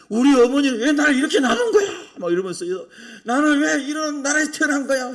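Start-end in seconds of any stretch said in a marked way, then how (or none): 0.1-2.1 loud, high outburst of resentment; acted bitter complaint. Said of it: "온갖 불평이 다 쏟아지는 거예요". 3.2-5.1 loud, high-pitched griping; acted indignant complaint. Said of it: "온갖 불평이 다 쏟아지는 거예요".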